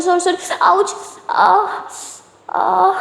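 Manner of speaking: crying out in pain. A narrator's reading. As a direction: yelp from a hurt hand. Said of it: "दर्द के मारे ना चिल्लाने".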